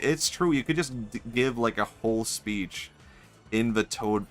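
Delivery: ordinary talk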